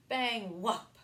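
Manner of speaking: plain reading voice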